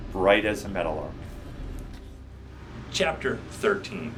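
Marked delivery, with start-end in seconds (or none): none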